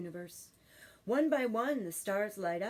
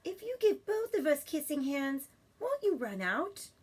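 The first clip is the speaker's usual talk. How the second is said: put-on child voice; 7 semitones higher, 4 dB louder than usual. Child asks.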